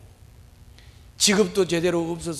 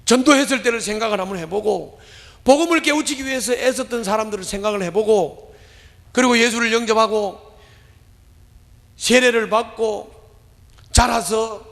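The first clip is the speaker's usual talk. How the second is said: rising, emphatic sermon build; fervent pulpit delivery; preaching; 5 semitones higher, 4 dB louder than usual. Acted. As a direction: preach it up higher and harder.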